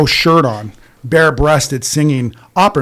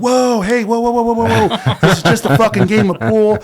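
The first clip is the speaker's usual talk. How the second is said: higher pitched placating whoa; calming him down; 6 semitones higher than usual. Retold or imitated. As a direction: back off, easy, defuse it. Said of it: "I was like".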